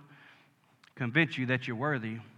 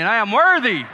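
much higher and loudest, full conviction; mean every word of it; fervent shouted declaration; said like you mean it; 7 semitones higher, 10 dB louder than usual. Shown as a demonstration. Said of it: "say it like you mean it".

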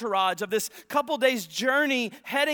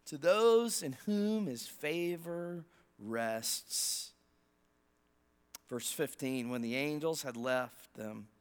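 scripture reading; 5 semitones lower, 3 dB quieter than usual. Acted. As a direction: read the Christmas story reverently. Reads the Bible passage aloud.

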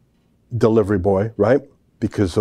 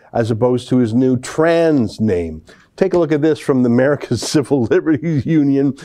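ordinary talk

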